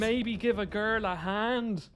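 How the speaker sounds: slow, overdone copy of the game's Irish lilt; mocking the game's Irish accent. Gamer mocks the game's bad Irish accent, slow overdone copy. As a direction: parrot the bad Irish accent back, slowed right down. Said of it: "why can't they do Irish accents right".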